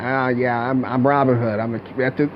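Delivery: put-on American accent, dropping the act; lazy American accent. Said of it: "And it comes out".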